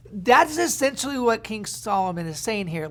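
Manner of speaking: ordinary delivery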